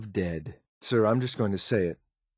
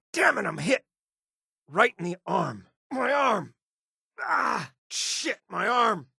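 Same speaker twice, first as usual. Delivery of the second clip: much higher pained cries, a hissed curse; crying out, arm hit; 12 semitones higher than usual. Acted. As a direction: gasp through the shock of a wound. Companion shot in arm.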